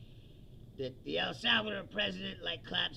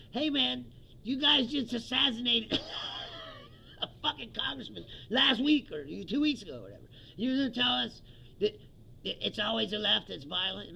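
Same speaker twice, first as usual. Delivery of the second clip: loud, higher, furious comeback with a crack; angry retort; 7 semitones higher, 5 dB louder than usual. Retold or imitated. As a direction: yell it at the pundit across the table. What the Democrat should've said.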